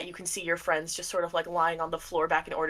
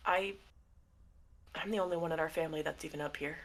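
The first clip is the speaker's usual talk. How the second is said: soft, halting, with long pauses; heavy-hearted confession; 9 dB quieter than usual. Acted. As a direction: quietly struggle to get it out. Dad's heavy confession to his daughter.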